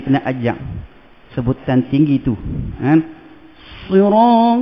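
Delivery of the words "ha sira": ordinary delivery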